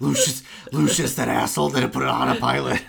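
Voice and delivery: loud, hammed-up cursing through laughter; mock rant. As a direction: rail at the absent engineer, half laughing. Comic riff cursing the engineer.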